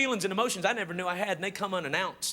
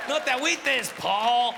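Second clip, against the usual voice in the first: Chicano slang; 4 semitones higher, 3 dB louder, slower than usual. Retold or imitated. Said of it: "they say weird shit like".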